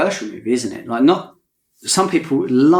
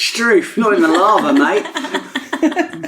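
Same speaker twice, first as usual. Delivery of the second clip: hammed-up Australian accent, pitched higher, laughing by the end; mock Australian accent; 8 semitones higher, much slower than usual. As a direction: play an Aussie bloke refusing, in a put-on Australian accent. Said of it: "You say no in an Australian accent?".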